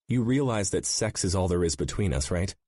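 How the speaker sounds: angry. A narrator's reading. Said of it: "she said angrily".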